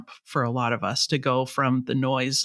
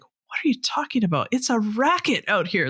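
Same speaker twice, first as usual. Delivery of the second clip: incredulous; 8 semitones higher than usual. Retold or imitated. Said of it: "And I'm like".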